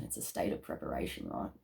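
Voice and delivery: "It's a state of preparation" in a hushed whisper. Shown as a demonstration. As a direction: breathe "It's a state of preparation" softly, as a secret. Repeats phrase as a whisper.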